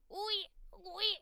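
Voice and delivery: high squeaky Wee, wee; a piggy high Wee, wee; squeaked wee wee. Retold or imitated. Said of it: "I really squeaked".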